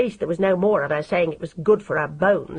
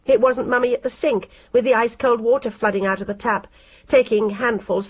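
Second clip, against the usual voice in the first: plain reading voice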